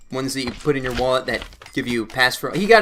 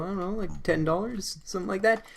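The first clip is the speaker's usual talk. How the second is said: soft and a bit higher, nervously vague; timid answer; 5 semitones higher, 9 dB quieter than usual. Retold or imitated. Nervous teen telling the hooligan the tie's price, soft and small.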